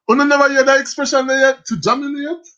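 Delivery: can't believe they never heard it; incredulous. Real, not acted. Host asking Empress in disbelief, high incredulous.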